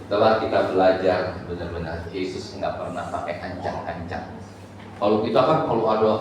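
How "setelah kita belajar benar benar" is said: ordinary delivery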